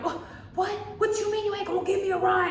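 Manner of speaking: loud, high, fast outburst; hammed-up indignant tantrum; mock hissy fit. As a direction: imitate a coworker losing it. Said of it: "losing it".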